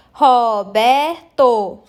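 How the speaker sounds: Roberto very slowly as Ro-ber-to; syllable by syllable. Shown as a demonstration. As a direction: pause between each syllable of Roberto. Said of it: "Separar os pedacinhos da palavra". (Roberto separado em sílabas, bem devagar)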